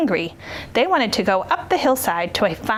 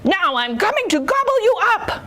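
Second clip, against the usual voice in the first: raised-pitch troll threat; roaring troll; 10 semitones higher than usual. A narrator's reading. Troll threatens the biggest goat, high-pitched roar. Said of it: "roared the troll".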